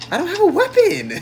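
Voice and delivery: high laughing complaint; high-pitched protest. Real, not acted. Player complaining to teammate about weapons, high-pitched protest.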